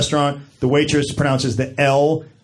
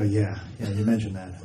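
muttered aside; 6 semitones lower, 9 dB quieter than usual. Retold or imitated. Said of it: "I go".

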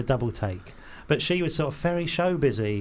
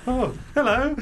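higher, tentative greeting; sheepish hello; 9 semitones higher than usual. Retold or imitated. Speaker answering the actress's wave, sheepish. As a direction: greet her as if caught out. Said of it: "And I was like".

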